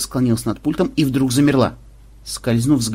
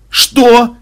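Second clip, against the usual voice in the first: high, very loud incredulous yelp; stunned shout; 12 semitones higher, 11 dB louder than usual. Acted. Commander hears chanting from the gunners, yelling stunned. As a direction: yell it in utter disbelief.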